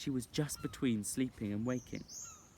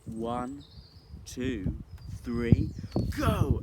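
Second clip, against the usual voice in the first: rising count to a shout; children count down before jumping in; building count; 2 semitones higher, 7 dB louder, much slower than usual. Acted.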